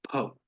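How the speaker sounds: "फ" with both lips closed, then a breath; bilabial ph. Shown as a demonstration. Teacher repeats for class the correct ph. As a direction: model "फ" with lips together. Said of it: "जिसमें दोनों होठ मिल रहे हैं".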